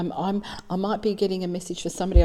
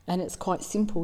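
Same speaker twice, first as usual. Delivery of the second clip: normal talking voice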